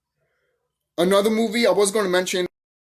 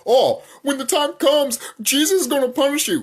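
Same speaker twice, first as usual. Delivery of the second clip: mocking believer; 7 semitones higher than usual. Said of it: "I'm a god".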